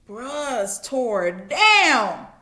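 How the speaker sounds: slow lament. Acted. Friends seeing ruined Job.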